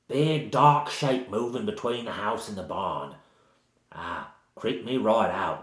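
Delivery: rambling, repetitive Cockney villager; bad Cockney accent. Village woman recounting the shadow that creeped her out, in bad Cockney. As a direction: run on breathlessly in mock Cockney. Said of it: "she has a sort of bad Cockney accent".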